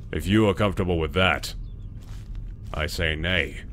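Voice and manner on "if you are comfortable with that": normal talking voice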